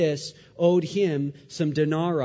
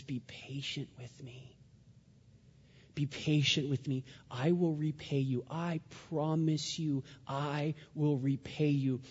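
hushed pleading; 2 semitones lower, 8 dB quieter than usual. Retold or imitated. Servant begging the king for more time, hushed pleading. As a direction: plead quietly, drawing out the promise. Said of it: "and he pleads with him".

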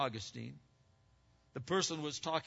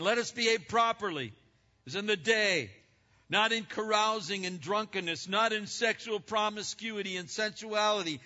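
scripture reading; 7 semitones higher, 6 dB louder than usual. Acted. Preacher reading Romans to the congregation, declaiming. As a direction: read out louder and higher, declaiming. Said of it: "in Romans thirteen says".

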